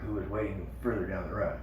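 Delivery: ordinary delivery, nothing marked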